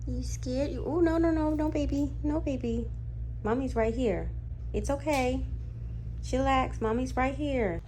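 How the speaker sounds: calm her down, sweet and high. Real, not acted. Soothing scared cat at the vet.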